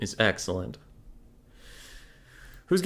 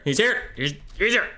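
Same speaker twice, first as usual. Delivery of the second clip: high, rising "He's here!"; thrilled the player showed up; excited; 8 semitones higher, 3 dB louder than usual. Real, not acted. Streamer thrilled the missing player showed up, high excited rise.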